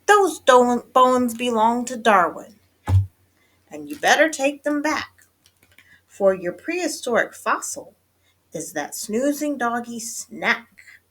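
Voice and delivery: high, loud scolding; ordering mother. A narrator's reading. Mama orders her kitty boy to return bones, firmly. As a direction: lay down the law as Mama. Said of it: "ordered Mama".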